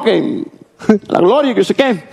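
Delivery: high, put-on voice of the singer [name]; [name] impression. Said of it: "como dijo [name]". (imitando a [name] con voz aguda)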